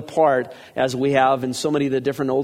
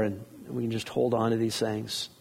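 usual speaking voice

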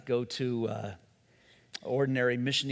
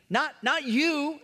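you held long and raised; slow down and lean on you; stress on you; 10 semitones higher, slower than usual. Shown as a demonstration. Preacher showing Peter's protest, stress on you. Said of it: "Emphasis on you".

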